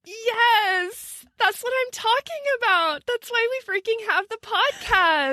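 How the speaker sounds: high-pitched, triumphant cheer; excited approval. Real, not acted. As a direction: celebrate it with real delight. Delighted cheer at the punchline.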